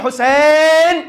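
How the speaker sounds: HUSSAIN called out high and loud; lamenting cry. Shown as a demonstration. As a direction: wail the name out in mourning. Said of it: "جب آپ کہتے ہیں".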